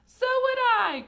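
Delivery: put-on very high, very loud old-woman cry; shrieking agreement; cried out. A narrator's reading. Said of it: "cried Grandma Georgina".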